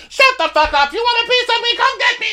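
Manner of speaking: very high, loud picking a fight; squeaky drunk challenge; belligerent drunk. Retold or imitated.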